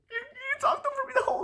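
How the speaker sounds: very high mock voice; mocking girlfriend. Guy mimics date's accusation in a high whine. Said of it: "this whole".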